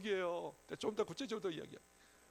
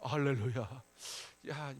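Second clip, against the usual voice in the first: ordinary talk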